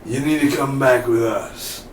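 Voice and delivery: slow, measured villain voice; put-on slow, deliberate threat; imitating Wade's talk. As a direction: draw out each order like a pursuer. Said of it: "he talks a little bit like this".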